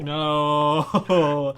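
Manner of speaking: loud, long-drawn no; protesting. Real, not acted. Refusing co-host's game plan, loud drawn-out protest. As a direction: wail it out in playful protest.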